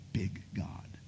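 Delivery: hushed reverent whisper. Acted. Preacher to congregation, reverent, in a whisper. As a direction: whisper it like a secret. Sermon climax.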